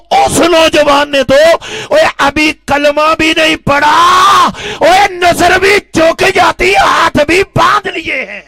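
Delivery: loud, high preaching cry; fiery shout. Real, not acted. Preacher shouting to the congregation about the youth. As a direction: hurl it at the crowd.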